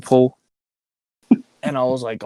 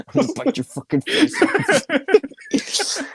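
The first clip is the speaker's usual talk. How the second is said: giggling whisper through the threat; laughing, hushed mock threat; much slower than usual. Real, not acted. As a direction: whisper it while cracking up.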